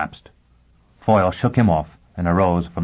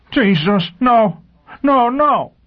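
very high, loud panicked cries; shrieked, frantic refusal; hysterical scream; 6 dB louder, slower than usual. A narrator's reading. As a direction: scream in terror, pleading no. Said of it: "began to scream hysterically".

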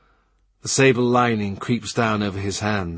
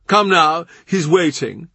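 higher, louder urging; hurrying; 7 semitones higher, 4 dB louder than usual. Acted. Norris urges Cromwell in to the king, higher and louder. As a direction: hurry them along.

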